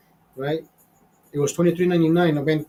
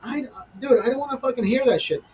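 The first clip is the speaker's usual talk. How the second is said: exasperated rant; 6 semitones higher than usual. Real, not acted. Fifty-year-old ranting about restaurant music, exasperated and high. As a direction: pitch jumps high in disgust.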